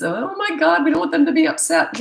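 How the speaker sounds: high, loud, fretting parent voice; hammed-up anxious parent gasping over upset kids; mock pleaser parent. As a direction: squeal it like a panicky people-pleasing parent. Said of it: "the pleaser parent style".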